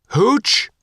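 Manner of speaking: high, loud call of a name; shout. A narrator's reading. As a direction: call him across the deck, high and loud. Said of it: "Faith shouted".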